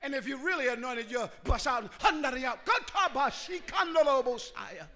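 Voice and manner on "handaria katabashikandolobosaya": fluent run of ecstatic syllables; rolling glossolalia; speaking in tongues. Shown as a demonstration. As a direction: burst into tongues mid-sermon. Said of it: "Start speaking in tongues".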